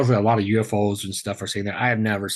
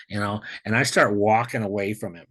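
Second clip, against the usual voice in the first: ordinary talk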